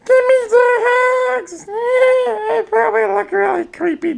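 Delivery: very high squeaky voice, slowed down and pleading; high creepy creature voice. Acted. Voicing a photo's creature for viewers, very high and squeaky. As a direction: beg for hugs like a weird little critter. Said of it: "try and make voices for stuff".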